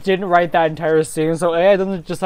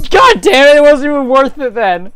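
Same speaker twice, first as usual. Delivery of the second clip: frustrated outburst; 7 semitones higher, 7 dB louder than usual. Real, not acted. Angry at own hard slap.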